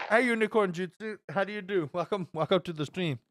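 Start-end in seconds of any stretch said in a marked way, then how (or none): none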